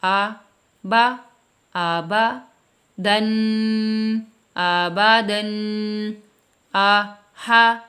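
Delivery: loud, slow syllable sounding; sounded-out syllables. Shown as a demonstration. Teacher showing parents how to sound words out, slow blending. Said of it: "آپ اس طرح پڑھائیں".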